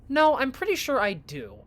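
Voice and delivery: loud, high-pitched insistence; heated retort. Acted. Friend insists during an argument, heated retort. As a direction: push back hard, raising your voice.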